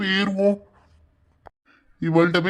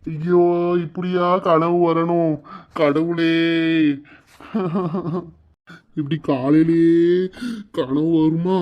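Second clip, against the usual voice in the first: tearful wailing; 4 semitones lower than usual. Acted. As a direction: drag the words out through mock sobs. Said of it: "இப்படி அழுதுட்டு கிடக்காரு". (அழுது புலம்பல்)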